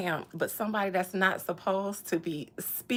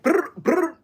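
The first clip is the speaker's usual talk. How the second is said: high loud rolled brr ad-lib; rapper ad-lib brr; 7 semitones higher, 8 dB louder than usual. Retold or imitated. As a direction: hype trill like a rapper.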